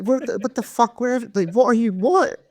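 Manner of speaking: very high, spluttering disbelief; high-pitched spluttering at the news reaction; incredulous. Real, not acted. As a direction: squeak out bewildered outrage. Mocking outrage at late reaction.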